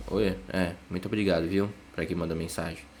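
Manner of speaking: grave, baixo e arrastado, sem vontade; agradeça como quem não quer agradecer; desanimado. Shown as a demonstration. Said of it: "A entonação de fala já diz muita coisa". (agradecimento grave e desanimado)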